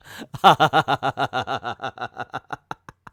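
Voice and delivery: long helpless real laugh; can't stop laughing at the page; a fit of real laughter; burst of laughter. Real, not acted. Cracking up at the comic.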